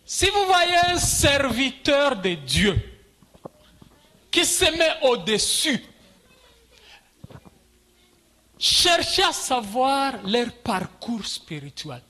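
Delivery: high, emphatic preacher's delivery with long pauses; forceful preaching. Real, not acted. Preaches to congregation in French. (prédication énergique, voix haute)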